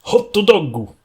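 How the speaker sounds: hot dog as Japanese katakana, hotto doggu, pitched high; Japanese accent. Shown as a demonstration. Friend demos the Japanese word for hot dog in a Japanese accent. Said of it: "Just hot dog in a Japanese accent".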